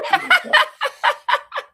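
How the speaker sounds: a loud, high squeal of laughter. Real, not acted. Laughing at the friend's joke about the scammer.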